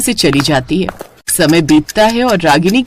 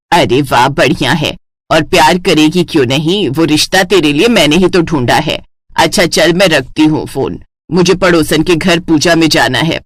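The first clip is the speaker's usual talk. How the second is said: much lower, self-satisfied mother; smug mother; 5 semitones lower than usual. Acted. Mother boasting she found the match, much lower voice. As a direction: pat yourself on the back.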